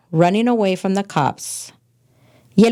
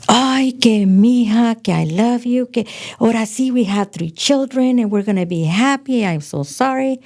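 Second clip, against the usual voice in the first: pleading ex; 5 semitones higher than usual. Retold or imitated. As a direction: mimic his sugary begging, voice pitched up. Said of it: "he goes and gives me a bear hug".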